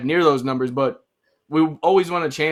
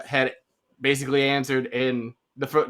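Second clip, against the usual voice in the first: ordinary delivery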